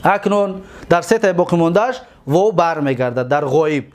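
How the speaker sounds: ordinary talk, nothing marked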